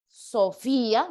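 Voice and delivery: "Sofía" slow, with a long stressed "í"; sounded out. Shown as a demonstration. Teacher confirming a pupil's answer, "Sofía" slowly sounded out. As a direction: stretch out the hiatus in "Sofía".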